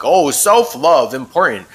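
breezy parody of a trendy slogan; mock casual. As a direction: toss it off like a glib cliché. Mocking what people casually say.

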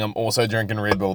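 usual speaking voice

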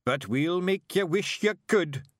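high, loud, slow broad Scots threat; Scottish battle yell. Acted. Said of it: "he yelled".

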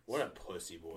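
whispered aside; whispering. Real, not acted. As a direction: whisper the insult. Whispered dig.

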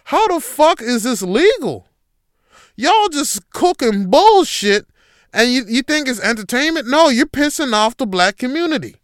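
high, loud furious quote; angry rant. Retold or imitated. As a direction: blast it like you're fed up. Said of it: "I was upset".

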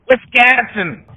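thick Wisconsin accent, drawn out slowly. Shown as a demonstration. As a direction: stretch out "Wisconsin!" in a hammy Wisconsin accent. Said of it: "my terrible Wisconsin accent when I say".

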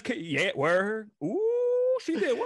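squealing high, wailing surprise; playing a shocked onlooker; mock shock. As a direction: act outraged at the cheating news. Acting shocked husband listener.

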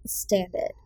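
usual speaking voice

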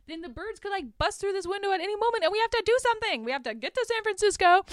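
hammed-up alarm, voice climbing high; panicked plea. Panicked diner woman, retold.

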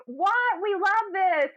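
high, jeering crowd voice; mock crowd. Bar crowd taunting the new supervisor, jeering. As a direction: jeer at her like a rowdy bar crowd.

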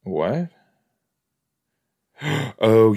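ordinary talk, nothing marked

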